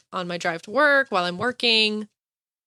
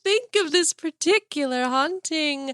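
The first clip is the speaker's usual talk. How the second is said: high, slow, eerie outro voice; hammed-up ghostly announcer reading the sign-off; spooky ghost host; 7 semitones higher, slower than usual. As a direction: croon to the little phantoms, playfully haunting.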